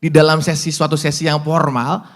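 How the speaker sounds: ordinary talk, nothing marked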